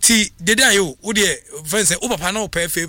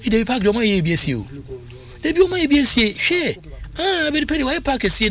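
sudden high, fast excited burst; excited exclamation that jumps up high; 8 semitones higher than usual. Real, not acted. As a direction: blurt it out in amazement. Excited exclamation about David Park.

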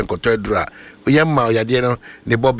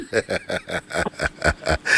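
laughing at own joke; hearty laugh; 5 semitones lower, 4 dB quieter than usual. Real, not acted. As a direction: let a big laugh roll out. Laughs after teasing remark.

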